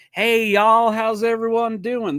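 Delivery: put-on singer's phony Southern accent greeting the crowd; fake Southern accent. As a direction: ham up a put-on Southern stage drawl. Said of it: "Southern accent".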